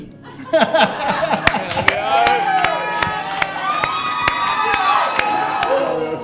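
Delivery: drawn-out high laughter; real laugh at the party; long high laugh. Real, not acted. Long laugh during speech.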